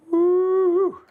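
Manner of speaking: loud high cheer; a loud victory woo; triumphant whoop. Real, not acted. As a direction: celebrate the win out loud. Cheering success.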